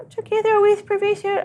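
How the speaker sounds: squeaky high house-elf voice; a quick squeaky elf impression; Dobby voice. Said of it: "Now do Dobby".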